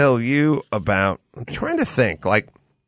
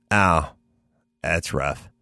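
much lower and much softer mock pity; mock sympathy; 10 semitones lower, 5 dB quieter, slower than usual. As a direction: drop low and soft, faking sympathy. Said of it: "And they go".

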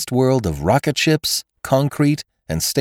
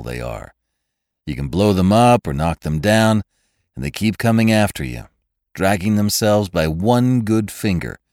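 low, wry hard-boiled narration; dry noir storyteller; noir detective; 6 semitones lower than usual. A narrator's reading.

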